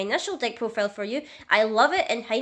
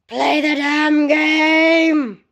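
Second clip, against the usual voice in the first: very slow, much louder, higher drawn-out yell; furious shout; 5 semitones higher, 11 dB louder, much slower than usual. Acted. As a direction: bellow the demand out like a fed-up gamer. Tracer nags her to play.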